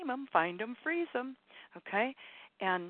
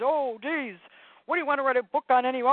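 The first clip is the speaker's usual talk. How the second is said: exasperated naysayer, loud and higher; Serious Sam; 6 semitones higher, 9 dB louder, faster than usual. Acted. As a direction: rush it out as a grumbling ego.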